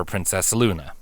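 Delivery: plain reading voice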